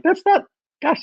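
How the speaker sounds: very high, dismayed exclamation; dismayed gosh. Retold or imitated. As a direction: pop up in disbelief. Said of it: "I'm like".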